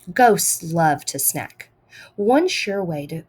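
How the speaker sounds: usual speaking voice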